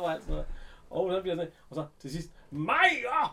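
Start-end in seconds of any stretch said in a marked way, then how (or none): 2.5-3.3 high, loud retold yell of a name; calling out. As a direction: shout the name up the stairs. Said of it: "råber han så".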